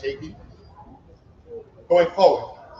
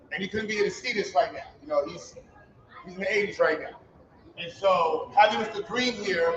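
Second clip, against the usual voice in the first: ordinary talk, nothing marked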